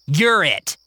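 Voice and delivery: put-on high, challenging girl; girl's voice. Mary asking the other kids, high and challenging. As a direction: put him on trial before the kids.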